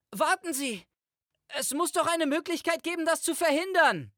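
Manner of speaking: very high, frantic cry; desperate plea. A narrator's reading. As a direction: plead urgently through the closing door. Desperate plea at closing door. (verzweifeltes, sehr hohes Flehen)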